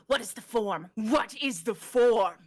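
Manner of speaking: hissing serpent demanding an answer; coiled snake, menacing in the ear. Acted. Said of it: "the snake speaks in your ear".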